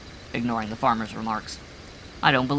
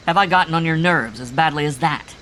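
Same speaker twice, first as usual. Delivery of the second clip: louder, higher, with a mocking jab; malicious cry; 6 semitones higher, 8 dB louder than usual. A narrator's reading. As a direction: needle him with spite. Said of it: "cried Dream maliciously".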